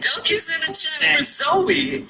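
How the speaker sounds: high squeaky voice. Pretend. High slow far voice.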